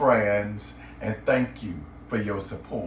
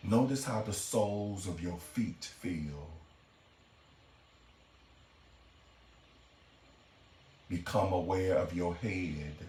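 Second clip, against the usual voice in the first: guided meditation; 5 semitones lower, 8 dB quieter than usual. Acted. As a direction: guide the listener gently into relaxation, lower and softer than a greeting.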